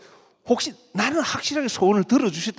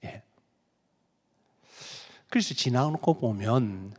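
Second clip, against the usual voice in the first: normal talking voice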